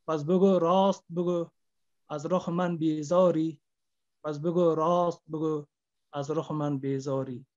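grieving poet reciting to the audience; poem recitation. Acted. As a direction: declaim each line like a lament to the beloved. Poem climax.

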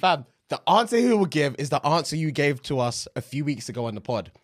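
ordinary talk, nothing marked

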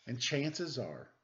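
put-on lively read-aloud for children; storybook reading. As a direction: read it like a bedtime picture book. Reads picture book aloud.

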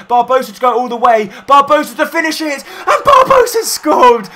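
building from eager to a screaming goal cry; excited goal call. Real, not acted. Gamer commentating his own last-minute equaliser, building to an ecstatic scream.

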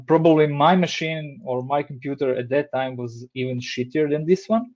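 ordinary delivery

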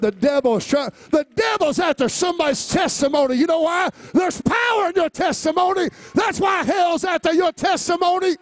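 high, loud shouted preaching; rising fiery sermon shout; preaching at full force. Acted. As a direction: preach it out to the whole church.

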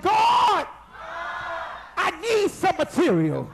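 shouted cry to God, voice up high; loud plea. Shown as a demonstration. Preacher leading the church, a loud shouted plea. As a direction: call out to God for what you need. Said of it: "Now you better say it like you mean it".

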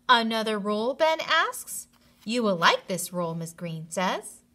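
lively storybook reading; reading to children. A narrator's reading. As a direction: keep the story-time voice going. Reading story aloud to class.

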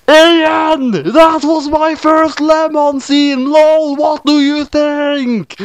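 very high, slow, drawn-out screech; shrieked author's note. Acted. Author calls to Ian about other fics, high drawn-out shriek. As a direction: screech it slowly, stretching the last words.

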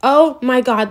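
ordinary talk, nothing marked

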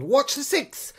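loud warning call; urgent shouted warning. A narrator's reading. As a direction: shout it across the water. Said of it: "I heard Alexandra call".

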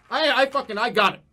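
loud, high triumphant I knew it; real triumphant outburst; triumphant shout. Real, not acted. Recognizes the cracker flavor.

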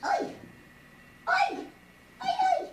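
sharp high calls; high calling out. Real, not acted. Calling the baby to stop.

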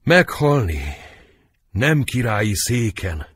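high, anguished stage cry; dramatic dying outburst; dying lament. Acted. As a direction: lament loudly as death comes. Dying queen's last words.

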